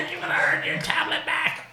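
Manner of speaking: high, mock-stern parent voice; a hammed-up mother bargaining with a child; imitating wife's stern voice. Father mimics his wife scolding their daughter, high and mock-stern. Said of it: "she's over there".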